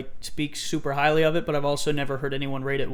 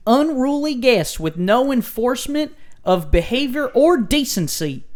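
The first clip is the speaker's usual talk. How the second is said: Haley impression, Southern accent; 7 semitones higher, 7 dB louder than usual. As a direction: read it as an outraged Southern reviewer, pitched way up. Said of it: "your impression of my voice".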